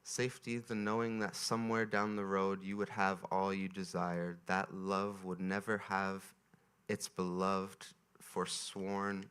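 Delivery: slow, measured reading to a crowd; reading aloud. Acted. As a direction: read it slowly, like a poem. Reads a passage to audience.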